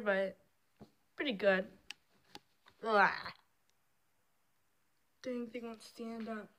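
ordinary talk, nothing marked

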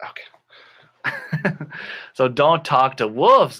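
ordinary delivery

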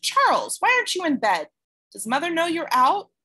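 big sister startled and scolding. Acted. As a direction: caught off guard, demand answers. Startled sister scolds little brother.

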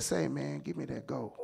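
very soft, low aside; sly undertone. Acted. Servant secretly asking for gold, hushed sly undertone. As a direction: keep it down, as if going behind his back.

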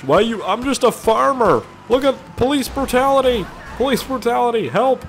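high, whiny hammed-up victim cries; mock plea of police brutality. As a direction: play the hard-done-by farmer crying for help.

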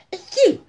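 loud high achoo breaking into a laugh; hammed-up sneeze cracking up. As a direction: sneeze big and giggle through it.

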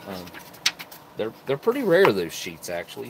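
ordinary talk, nothing marked

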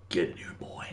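hushed whisper; whispered summons. Real, not acted. Streamer welcoming invited player into the realm, whispered. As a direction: whisper it as an aside.

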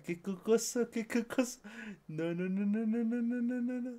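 climbing high excited no no no; rising excitement. Real, not acted. Friend escalating reaction.

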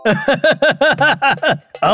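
genie laugh. Acted. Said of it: "একটা Jimmy বাইরে এলো".

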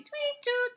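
high piping tweet; playful birdsong mimic; bird chirp imitation. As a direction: chirp it like a songbird.

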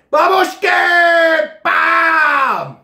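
long, high, full-voiced playful shout; countdown yell. Reviewer counting down to the mix, drawn-out playful shout. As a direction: hold each word out in a big yell.

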